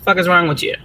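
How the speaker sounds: ordinary delivery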